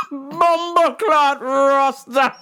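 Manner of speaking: high, slow, loud patois drawl; mock Jamaican patois. Comic mocking the guard in slow Jamaican patois.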